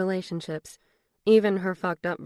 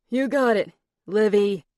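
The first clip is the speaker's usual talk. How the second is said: laughing tease; 3 semitones higher, 8 dB louder than usual. A narrator's reading. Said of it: "Simon walked away laughing".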